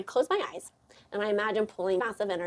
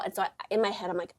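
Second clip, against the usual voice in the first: ordinary talk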